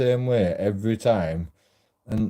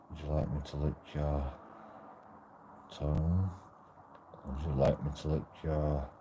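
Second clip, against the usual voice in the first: soft mock seduction; 6 semitones lower, 10 dB quieter than usual. Suggested bedroom lines for women.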